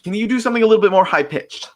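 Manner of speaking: quoted question, pitched well up and quick; high-pitched request. Retold or imitated. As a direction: lift it high, imitating the director. Quoting director's request, voice raised.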